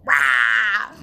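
loud ranting yelp; Alex Jones shout. Retold or imitated. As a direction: bark out a wild rant cry. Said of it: "That's my impression".

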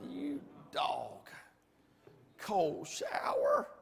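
slow, whispery grumble; muttered grievance. Retold or imitated. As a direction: grumble it under your breath, drawn out. Retold muttering under cold water.

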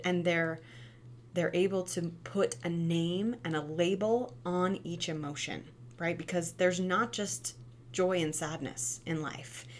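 ordinary talk

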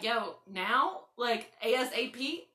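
slow, baffled "ASAP?"; incredulous demand. Retold or imitated. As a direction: ask as if it's obvious. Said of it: "And I was like".

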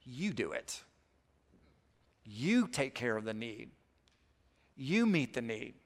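"You" hit hard each time, the rest soft; quiet lines with weight on "You"; stress on YOU. Shown as a demonstration. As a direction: lean into the word "You" in each short line. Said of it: "the emphasis with the word "you".

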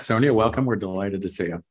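normal talking voice

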